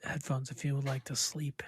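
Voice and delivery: hushed sleepy host voice; soothing whisper. Pretend. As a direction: murmur it like a lullaby. Joins sleep show whisper.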